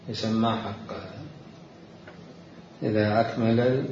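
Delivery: ordinary talk, nothing marked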